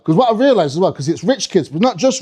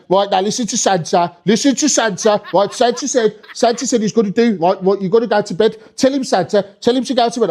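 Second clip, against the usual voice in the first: pitched-up Birmingham nagging; Birmingham parents; 3 semitones higher than usual. Acted. Birmingham mum to kid before Santa, nagging Brummie. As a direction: nag in a Brummie accent. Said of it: "they were proper women".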